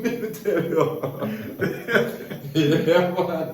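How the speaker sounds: laughing. Real, not acted. Cracking up with a friend over childhood beatings, laughing. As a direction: laugh out the words helplessly.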